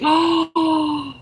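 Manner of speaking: high, drawn-out gasp of delight; sharp thrilled intake of breath; excited gasp. Real, not acted. Delighted reaction to fan's news.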